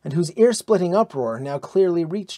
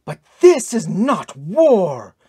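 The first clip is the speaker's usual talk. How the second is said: slow, loud, high protest; emphatic cry of protest; outraged outburst; 4 semitones higher, 6 dB louder, slower than usual. A narrator's reading. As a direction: burst out, leaning on each word. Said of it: "burst out Brynhild".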